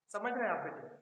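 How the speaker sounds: normal talking voice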